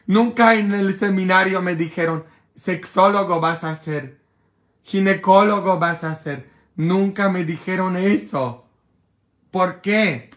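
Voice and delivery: indignant rant. Real, not acted. Priest complaining to viewers about the seminary, indignant.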